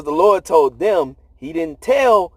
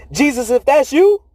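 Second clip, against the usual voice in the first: Peter calling out; 6 semitones higher, 4 dB louder than usual. Retold or imitated. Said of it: "Peter said to Jesus".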